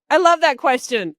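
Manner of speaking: loud, high I love that question with love hammered; over-eager canned stall; overdone placeholder. Shown as a demonstration. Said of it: "You can't go".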